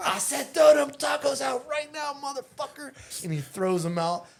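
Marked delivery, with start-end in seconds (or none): 0.1-2.9 high, loud shouted order; cop shouting. Retold or imitated. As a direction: bark it out like a fed-up cop.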